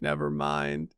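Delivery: deadpan retraction. Real, not acted. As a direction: drop it low in dry disappointment. Realizes Sim still burning.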